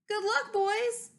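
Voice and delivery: high, slow call of goodbye; drawn-out high farewell cry; cried out. Acted. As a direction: call it out sadly and high as they leave. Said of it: "he cried".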